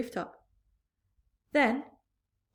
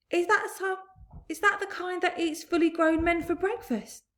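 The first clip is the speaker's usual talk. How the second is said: high fearful asking; frightened question; 9 semitones higher, 4 dB louder than usual. A narrator's reading. Fire chief asking fearfully about the creature. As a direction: ask in a quavering fright.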